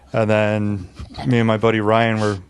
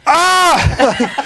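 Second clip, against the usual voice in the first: high, loud imitated cry of pain; imitated yelp of pain; 5 dB louder than usual. Imitating the stabbed man's high, loud yelp. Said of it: "He's like".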